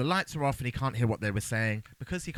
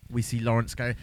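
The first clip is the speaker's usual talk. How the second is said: ordinary talk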